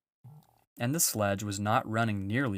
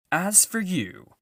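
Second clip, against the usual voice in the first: high, louder, slow threat; threatening witch; 9 semitones higher, 6 dB louder, slower than usual. A narrator's reading. Witch turning on Edmund, slow menacing threat. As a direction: turn on him coldly. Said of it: "said the witch".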